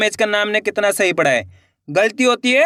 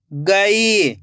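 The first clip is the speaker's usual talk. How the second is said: गई joined as one word with long ई; blended word. Shown as a demonstration. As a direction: read गई whole, stretching the ई. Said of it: "खींच के पढ़ना है".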